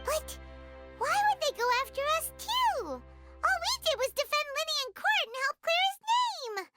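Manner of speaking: high, wailing complaint; indignant protest. Acted. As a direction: protest it as wildly unfair, voice climbing. Protests at being targeted.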